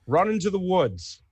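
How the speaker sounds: high-pitched call to flee; shouted order. Acted. Renmore sending civilians away from the dragon, shouting. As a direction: shout it as an urgent command. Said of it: "turn to them and shout in".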